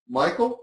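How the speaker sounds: Michael said with a rising, questioning end. Shown as a demonstration. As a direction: lift the pitch on Michael, as if asking. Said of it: "You just say it like a question".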